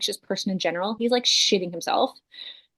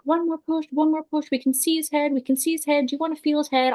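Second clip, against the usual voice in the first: cheerful staff; 6 semitones higher than usual. Retold or imitated.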